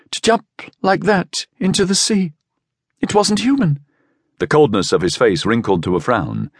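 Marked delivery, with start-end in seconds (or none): 0.1-3.8 high, thin voice shivering with shock; strained, thin. A narrator's reading. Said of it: "Her voice was so strained and thin".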